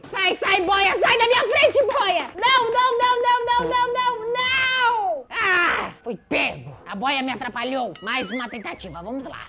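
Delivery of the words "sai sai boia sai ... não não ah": high, loud frantic shouts; panicked yelling. Real, not acted. The float blocks his escape, high frantic panicked yelling.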